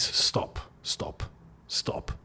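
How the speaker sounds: breathy whispered plea; whispered stop. Real, not acted. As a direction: whisper it, fading each time. Pleading with fans to stop.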